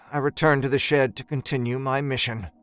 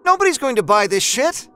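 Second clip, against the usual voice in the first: much higher and louder, cutting; furious wife; 11 semitones higher, 4 dB louder than usual. A narrator's reading. Wife about the overdue mortgage, high furious. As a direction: hit him where it hurts. Wife's angry outburst.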